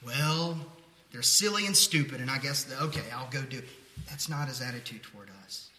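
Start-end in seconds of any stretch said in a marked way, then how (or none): 0.0-3.7 exasperated groan sinking into reluctant consent; begrudging. Acted. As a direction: grumble loudly, then grudgingly give in. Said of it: "He isn't begrudgingly like".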